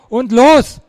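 loud, high command; urgent yell to set off; shouted go signal. A narrator's reading. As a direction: fire the starting signal. Signal to start running.

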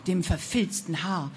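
ordinary delivery, nothing marked

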